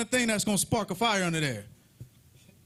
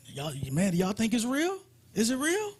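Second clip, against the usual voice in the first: nervous worshippers asking each other; worried whisper rising to a high squeak; doubting prophets; 5 semitones higher than usual. Acted. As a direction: voice climbing high in disbelief. Prophets of Baal doubting, acted.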